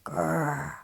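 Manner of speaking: soft breathy growl "Grrr"; dog growl. Acted. Reader voicing Jake the dog, a soft growl. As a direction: growl softly like a playful dog. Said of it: "He growls while he eats".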